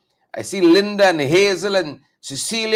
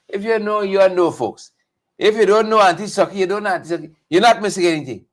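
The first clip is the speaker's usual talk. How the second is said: normal talking voice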